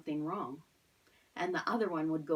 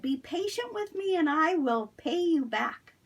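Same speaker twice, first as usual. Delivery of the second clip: much higher, louder pleading; desperate plea; 9 semitones higher, 7 dB louder than usual. Acted. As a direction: beg desperately not to be jailed. Fellow servant begs.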